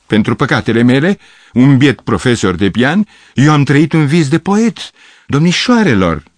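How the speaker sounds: high, loud wounded pride; offended declaiming. Acted. Gavrilescu defending his dignity to the girls, loud and pompous. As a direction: proclaim himself grandly, offended. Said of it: "strigă el jignit".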